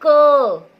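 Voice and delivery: loud call to repeat the sound; model for repeating. Shown as a demonstration. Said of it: "मेरे साथ दोहराइए".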